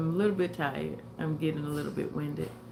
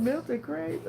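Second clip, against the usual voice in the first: laughing quote; 9 semitones higher than usual. Retold or imitated. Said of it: "She said".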